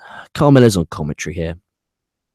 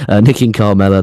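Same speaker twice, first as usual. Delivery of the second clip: normal talking voice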